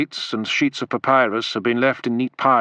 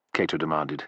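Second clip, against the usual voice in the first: ordinary delivery, nothing marked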